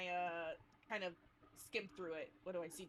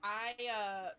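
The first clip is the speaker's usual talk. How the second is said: ordinary delivery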